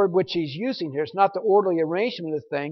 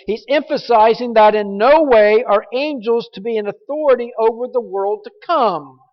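usual speaking voice

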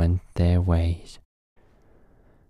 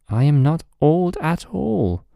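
lightly voiced proud old tree; a touch of offended fir tree; 10 semitones higher, 4 dB louder than usual. Tree replies to mice.

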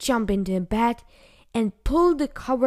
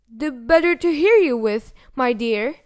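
louder, raised fake-granny voice; wolf posing as grandma; 4 semitones higher, 7 dB louder than usual. A narrator's reading. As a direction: answer as the sly wolf in disguise. Disguised wolf answers as grandmother.

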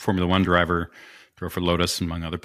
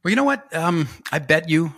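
much higher pitched, louder mock voice of a friend; imitating Marshall; 7 semitones higher, 3 dB louder than usual. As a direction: pitch it way up to send up your buddy. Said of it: "and he, he goes".

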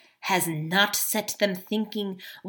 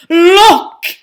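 high, loud shout of alarm; alarmed cry; 9 semitones higher, 13 dB louder than usual. Acted. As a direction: cry out to make everyone look. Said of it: "he cried".

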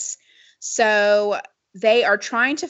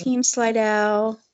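sexy voice; slower than usual. Pretend. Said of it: "do your sexy voice".

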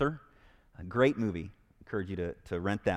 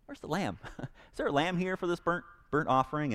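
lightly voiced puzzled son, higher pitch, chuckling; half-laughing, higher-pitched mock innocent question; Isaac questioning Dad; 9 semitones higher than usual. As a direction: ask haltingly, with a little laugh. Acting Isaac's awkward question to Dad.

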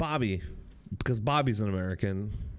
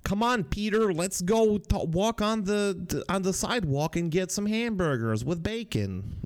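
high, hammed-up American demo; exaggerated American accent; 6 semitones higher than usual. Shown as a demonstration. Showing a British friend how Americans talk, exaggerated American accent. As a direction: push the American accent higher and harder. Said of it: "Americans, like, you know".

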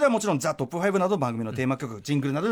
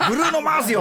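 high-pitched mock proclamation through laughter; mock title call; 9 semitones higher, faster than usual. Host echoes the guest's title call, mock-grand and laughing. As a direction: imitate a grand call, quick and amused. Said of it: "歌丸よシリーズってありましたけど".

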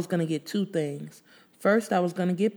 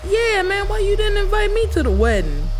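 barber teasing; 8 dB louder than usual. Acted. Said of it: "I heard one of the barbers say".

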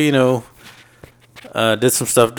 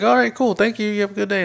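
high, chirpy mock-polite thanks; overly cheerful thanks; 11 semitones higher than usual. To his boss who lets him go home, a high chirpy mock-polite thanks. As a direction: sing it out, fake sweet.

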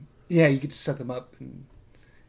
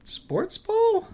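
mock sports fan; 3 dB louder than usual. Mocking sports talk.

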